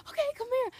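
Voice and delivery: very high, loud bubbly call; excited invitation. Retold or imitated. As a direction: call them in with bubbly joy. Taylor inviting hug.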